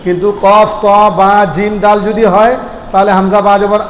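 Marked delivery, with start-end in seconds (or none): none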